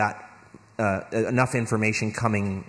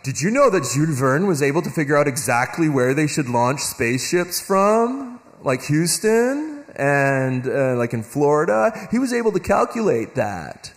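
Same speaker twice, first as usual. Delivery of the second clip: higher, eager mock enthusiasm; imitating people gushing over Verne's genius; impressed gushing; 4 semitones higher, 3 dB louder than usual. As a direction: gush like a wowed fan. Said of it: "everybody's like".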